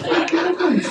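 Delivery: high, giggling brush-off; laughing refusal. Acted. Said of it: "He gets into a mood".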